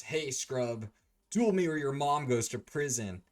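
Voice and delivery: loud cartoon-rival bravado; acted taunting challenge. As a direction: throw down a hammed-up challenge. Acted challenge by Kaiba in story.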